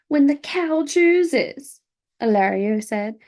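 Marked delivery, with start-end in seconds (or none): 0.1-1.8 drawn out, higher and louder; slow lofty reply. Acted. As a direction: let it roll out slowly and grandly.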